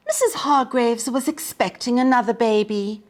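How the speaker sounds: plain reading voice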